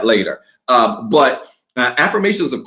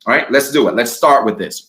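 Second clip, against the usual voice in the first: ordinary talk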